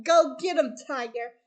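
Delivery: loud rallying cheer; cheering crowd. Retold or imitated. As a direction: shout it out to hype up the hero. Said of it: "putting out comments like".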